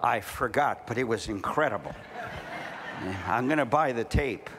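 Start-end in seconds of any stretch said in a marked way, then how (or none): 2.0-3.2 soft laugh. Real, not acted. Laughs at own joke.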